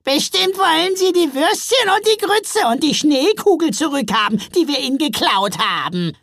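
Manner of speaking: very high, loud small troll; eager little troll. A narrator's reading. Little troll guessing what the Santas want back, high and blurting.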